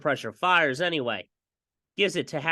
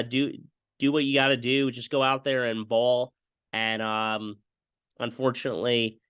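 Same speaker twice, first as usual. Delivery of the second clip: ordinary delivery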